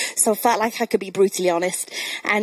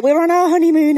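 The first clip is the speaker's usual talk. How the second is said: higher, louder mock-indignant reply; retold indignant protest; 7 semitones higher, 5 dB louder than usual. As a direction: insist on it, mock-affronted. Retold protest to people mistaking them for children.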